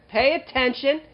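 emphatic plea to careless drivers; scolding exhortation. Real, not acted. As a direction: scold the listeners, pitched well up. Scolding listeners about careless driving.

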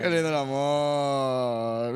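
slow, drawn-out mock-sappy sigh; a very slow, syrupy mock sigh; mock dreamy. Real, not acted. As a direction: sarcastically swoon over love. Sarcastic sigh over love.

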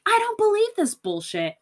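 high, loud mocking king voice; king scoffing. King dismissing the rumours, high scoffing. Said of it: "and the king's like".

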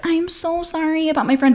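mock sheepish apology; apologetic date. Date apologises in retold story.

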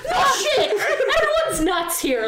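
high, incredulous outburst replayed with a laugh; retold exasperation.

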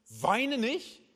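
high, rising, disbelieving question; acted disbelief, pitch shooting up on "nicht"; incredulous echo. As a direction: throw "Weine nicht" back as an indignant question. Widow throws Jesus' words back.

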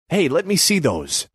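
high-pitched eager plea; eager, wheedling gangster voice; pleading. A narrator's reading. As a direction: beg for a look. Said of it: "Ace pleaded".

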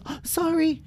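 a quick sheepish sorry up high; little girl apologizing to a fish; Lila apologizing. Acted. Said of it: "And Lila said".

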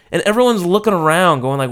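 usual speaking voice

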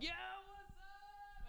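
high drawn-out call of "yo"; stretched slow greeting from far across the street; friends calling out. Retold or imitated. Friends yelling hello from a distance, drawn-out call.

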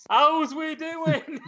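very high, drawn-out greeting; slow, squeaky-high mock catchphrase; comic catchphrase. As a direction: stretch it out high for a laugh. Swaps film line for catchphrase.

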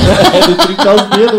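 laughing through the words. Real, not acted. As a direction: chuckle while agreeing. Laughing while agreeing.

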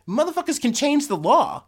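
high, loud outburst at "change"; outraged shout. Real, not acted. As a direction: blurt it out in disbelief.

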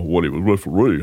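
lightly imitated referee voice; hint at a referee's voice impression; Jared Maxwell. Shown as a demonstration. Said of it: "I used to imitate Jared Maxwell's voice".

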